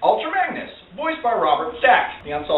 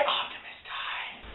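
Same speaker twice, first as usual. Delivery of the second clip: breathless stunned whisper; stunned hush; 16 dB quieter, slower than usual. Retold or imitated. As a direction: let the words die out in a hushed whisper. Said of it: "I was like".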